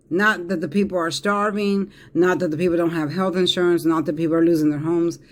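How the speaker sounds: ordinary delivery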